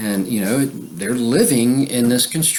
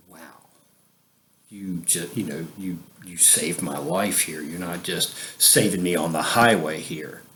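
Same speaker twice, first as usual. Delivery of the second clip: much softer, lower, stunned gratitude; awed quiet thanks; 6 semitones lower, 7 dB quieter than usual. Retold or imitated. Thanking the man who saved him.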